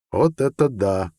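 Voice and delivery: slow, drawn-out exclamation of wonder; understated awe, drawn out; exhaled amazement. A narrator's reading. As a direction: let it out slowly, mildly amazed. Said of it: "выдохнул Зайд".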